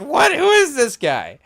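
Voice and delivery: imitating a player stunned by a question; mock baffled Chet. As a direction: play the star caught off guard. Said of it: "he's like".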